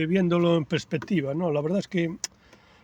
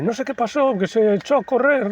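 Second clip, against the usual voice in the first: mother explaining; 9 semitones higher, 5 dB louder than usual. Retold or imitated. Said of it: "y mi madre".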